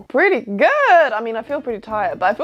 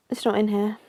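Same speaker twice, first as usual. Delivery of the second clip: ordinary delivery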